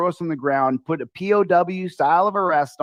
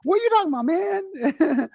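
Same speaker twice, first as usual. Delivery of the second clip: very high, fast quoted banter; high-pitched friend impression; 14 semitones higher than usual. Imitating his best friend from Brooklyn, high and fast. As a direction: imitate an excitable pal, voice far up. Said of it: "why you're talking so high pitch".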